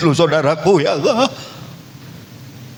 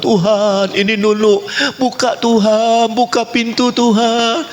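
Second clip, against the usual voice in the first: high, insistent plea at a door; calling out to God; 9 semitones higher than usual. Acted. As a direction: call out high, begging to be let in. Acting out pleading at the door.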